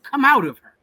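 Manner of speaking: a ringing divine cry to leave; shouted command. Retold or imitated. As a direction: cry it out as God's urgent call. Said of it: "That's the voice from God".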